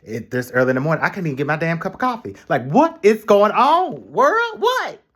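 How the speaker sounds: fast, then a very high, loud cry to the world; throwing it up to the sky; rising to a shrieking what; exasperated rant. Retold or imitated. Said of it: "I'm about to snap".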